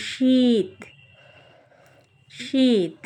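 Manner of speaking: শীত with a long, held ঈ; long vowel demo. Shown as a demonstration. Teacher explaining দীর্ঘিকার to learners, vowel drawn out. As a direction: stretch the ঈ in শীত. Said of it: "বেশি সময় ধরে উচ্চারণ হয়".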